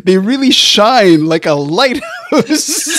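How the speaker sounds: high-pitched words through giggles; real helpless laughing. Real, not acted. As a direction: try to reassure while cracking up. Cracks up explaining the compliment.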